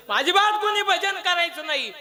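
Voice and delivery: high, loud proclamation; stern decree. Acted. Demon king bans hymns in the village, stern decree. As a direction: forbid it forcefully. Demon king's ban on devotional singing.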